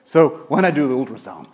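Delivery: ordinary delivery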